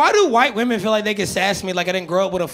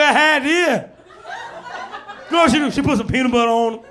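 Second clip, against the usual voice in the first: Bernie Mac bit; Bernie Mac impression. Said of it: "show off my Bernie Mac impression".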